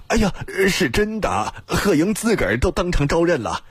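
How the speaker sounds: high-pitched, earnest insisting; insistent underling. A narrator's reading. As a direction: insist it is true, voice pitched right up. Officer insists to doubters it's true. (差役急忙辩解，音调很高)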